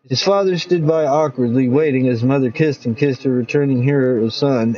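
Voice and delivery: plain reading voice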